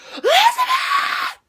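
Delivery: very high, drawn-out mock scream; hammed-up shriek for a lost friend; horror-movie scream. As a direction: scream the name like a horror spoof. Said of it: "And screamed like how you did with Josh".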